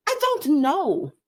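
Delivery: high, drawn-out mock exasperation; exasperated parent. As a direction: stretch it out in exasperated protest. Mock answer to son's dinner question.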